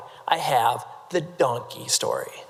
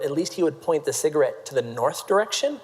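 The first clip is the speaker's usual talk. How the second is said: ordinary delivery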